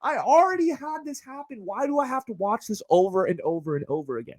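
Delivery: high-pitched fed-up whine; exasperated complaint. Acted. Viewer sick of wedding videos, exasperated. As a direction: plead in a high voice, easing down by the end.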